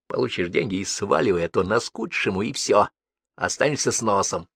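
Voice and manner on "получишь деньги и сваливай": ordinary delivery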